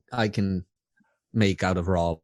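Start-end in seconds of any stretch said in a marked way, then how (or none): none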